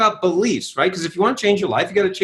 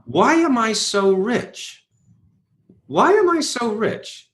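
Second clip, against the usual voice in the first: slow question; 4 semitones higher, slower than usual. Shown as a demonstration. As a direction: ask it slowly and deliberately. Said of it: "And I said".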